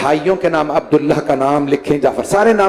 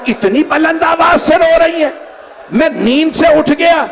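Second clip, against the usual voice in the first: wailing cry; 11 semitones higher, slower than usual. A narrator's reading. Wife tells the old man Husain is leaving, wailing. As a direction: cry it out in grief. Climax of majlis lament, shouted.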